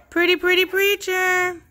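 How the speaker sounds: high cooing praise. Real, not acted. Owner cooing at the dog Preacher in high baby talk. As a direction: coo pretty pretty way up high.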